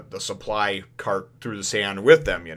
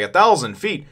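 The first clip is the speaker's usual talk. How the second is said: higher, louder shocked exclaim; mock disbelief; 4 semitones higher, 8 dB louder than usual. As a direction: gasp at the size. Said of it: "Usopp's like".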